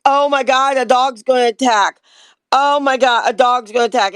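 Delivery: overreacting bystander raising the alarm; mock panic. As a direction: cry out in exaggerated alarm. Acting people panicking at a dog.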